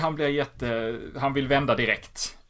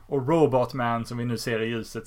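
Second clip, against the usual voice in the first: ordinary talk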